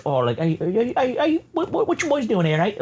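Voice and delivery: a hammed-up Irish lilt, rising as he stumbles; playful imitation of an Irish actor's accent. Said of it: "talking to his fucking Irish".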